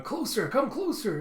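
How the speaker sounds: high, slow, leering call; acted sing-out beckoning; Orochi chasing women. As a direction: draw it out in a high voice. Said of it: "Orochi going and chasing some women".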